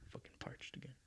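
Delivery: very quiet, whispered aside; muttered aside. Real, not acted. Speaker breaks off his story because he is parched, whispered aside. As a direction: whisper it to yourself, barely audible. Aside while pausing for a drink.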